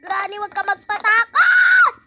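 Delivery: loud laughing yell at the game character; half-laughing shriek, telling Granny not to scare her; scared shout. Real, not acted. Gamer spooked by Granny, yelling with a laugh.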